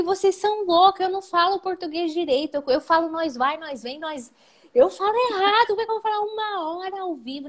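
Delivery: high, pleading protest in mock panic; retold panic. Telling the bosses they're crazy, in retold panic. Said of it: "Eu entrei em pânico".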